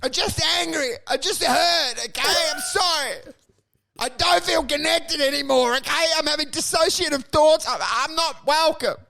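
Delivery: high, whiny pleading. Retold or imitated. Man on the ground pleading with the old guy, high whiny begging. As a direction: apologize desperately in a high voice. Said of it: "I'm like".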